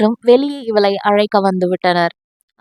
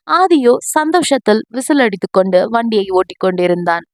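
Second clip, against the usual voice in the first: usual speaking voice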